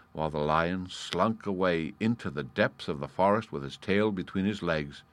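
usual speaking voice

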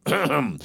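pompous mock throat-clearing. A narrator's reading. Colonel's throat-clearing, acted.

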